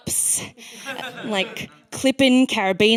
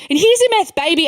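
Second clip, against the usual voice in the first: mock dealer; 5 semitones higher, 5 dB louder than usual. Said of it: "the guy goes".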